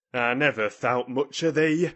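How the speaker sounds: loud Yorkshire scolding; haranguing. A narrator's reading. Ben scolding Mary over the wall, haranguing. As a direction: harangue her in broad dialect. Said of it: "he harangued".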